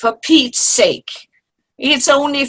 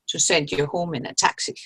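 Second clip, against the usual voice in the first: ordinary talk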